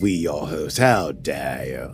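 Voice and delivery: low mock-British indignation; put-on British accent. Host defends his grammar, low put-on British accent. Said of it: "move flawlessly between accents".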